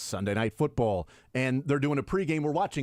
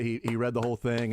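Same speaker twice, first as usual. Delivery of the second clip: ordinary talk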